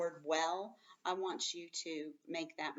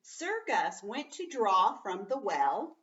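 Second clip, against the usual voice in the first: slow, clear read-aloud; teacher reads the story aloud to the class; 2 semitones higher, 5 dB louder, slower than usual. Acted. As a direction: read it out for the children.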